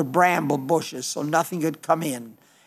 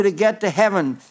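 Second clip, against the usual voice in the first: normal talking voice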